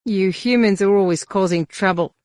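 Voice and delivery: a hint of a grumbling alien bouncer. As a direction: grumble it, lightly voiced. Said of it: "the Whiffet grumbled".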